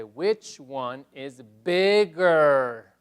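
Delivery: teacher reading the slide question slowly; slow model reading. Shown as a demonstration. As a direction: spell it out slowly for the class. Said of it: "hemos planteado esta pregunta".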